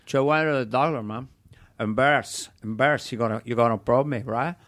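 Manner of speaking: put-on Tony Montana Cuban accent; embarrassed Cuban gangster voice; Scarface Cuban accent. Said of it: "as Scarface".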